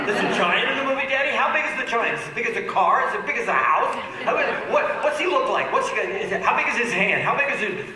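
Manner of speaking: put-on high, eager kid voice, questions tumbling out; excited little girls. Daughters peppering daddy about the giant, high and eager. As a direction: pile on the questions like a wide-eyed toddler.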